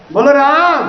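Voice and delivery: calling out to the crowd, high and loud. Real, not acted. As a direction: rouse the gathering with a loud call. Leader calls crowd to chant. (ज़ोर से पुकार)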